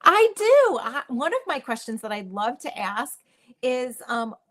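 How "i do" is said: high eager "I do"; excited. Real, not acted. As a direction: jump in with delight. Eager answer on the hot seat.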